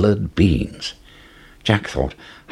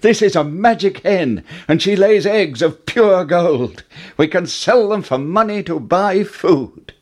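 put-on high, eager boy with news; excited cry; 10 semitones higher, 3 dB louder than usual. A narrator's reading. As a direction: burst out with the good news, pitched high. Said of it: "Jack cried".

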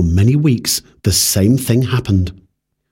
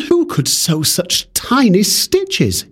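higher, slow puzzled question; wondering; 7 semitones higher, slower than usual. Acted. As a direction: marvel aloud at the stitches. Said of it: "he wondered".

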